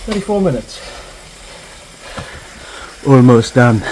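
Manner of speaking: strained shout mid-effort; cycling hard. Real, not acted.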